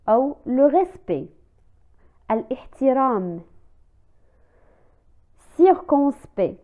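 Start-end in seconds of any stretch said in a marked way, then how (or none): 5.6-6.6 circonspect stretched out very slowly, ct silent; slow careful circonspect; slow demo. Shown as a demonstration. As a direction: spell circonspect out slowly for the class. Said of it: "لا ننطق لا لتر س".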